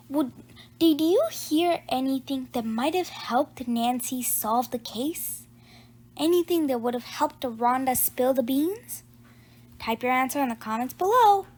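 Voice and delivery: ordinary delivery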